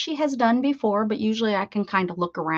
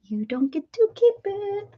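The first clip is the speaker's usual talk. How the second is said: high playful scolding; teasing; 11 semitones higher than usual. Pretend. Teasing a viewer who lost her welcome, high playful scold. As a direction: wag a finger with your voice.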